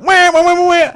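loud, high wah-wah horn noise; imitated blowhorn. Retelling Kyle cheering at the pageant, mock horn honking. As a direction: honk it out in a high voice. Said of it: "blowing the blowhorn and screaming".

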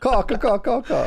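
crow call. Pretend. Crow call instead of duck.

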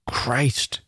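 quick muttered curse, forced; spat curse. A narrator's reading. Arnold swearing to himself after wobbling, a forced spat curse. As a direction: spit it out as an aside. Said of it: "fast, spat curse".

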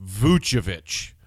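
Vucevic said slowly, voo-cheh-vitch with a final ch; careful VOO-cheh-vitch, drawn out; correct Vucevic. Shown as a demonstration. Podcast host teaching listeners the right way to say Vucevic. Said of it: "the correct phonetic spelling out of the correct pronunciation".

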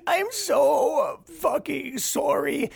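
very high, slow, fake-crying apology; mock sob. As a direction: wail it out like a hammed-up crybaby.

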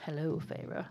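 understated suave hello; male rescuer's greeting. Retold or imitated. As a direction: quietly voice the man arriving. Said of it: "hear him saying that in a British accent".

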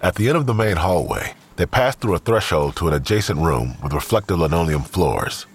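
usual speaking voice

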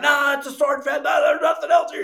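high loud shouted no; hammed-up denial yell; refusing to believe, shouting; mock protest. Crew insisting it is swordfish.

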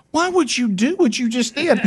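high mock wife voice, indignant; imitating wife. Husband imitating wife scolding him on Mother's Day, high mock indignation. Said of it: "She goes".

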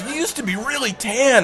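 high-pitched surfer-dude imitation; imitated surfer wonder; reminiscing surfer. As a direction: recall it in a high bro voice. Surfer recalls supposed friend.

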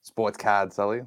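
extreme Boston Southie accent, slow. Shown as a demonstration. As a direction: drawl it like a Southie Boston local. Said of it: "in an extreme Southie accent for me".

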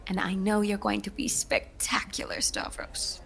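soft whispered pep talk; hushed encouragement. Acted. Stage manager whispers encouragement to Stavros before curtain. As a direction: whisper him some quiet confidence.

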